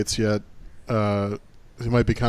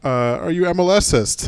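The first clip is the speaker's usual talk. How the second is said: put-on Jamaican accent; 7 semitones higher than usual. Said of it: "in a Jamaican accent's like".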